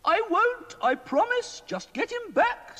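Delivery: high, fussy queenly voice; a worried queen pleading with the wizard. A narrator's reading. Queen complaining to Mister Ben about the king, high queenly voice.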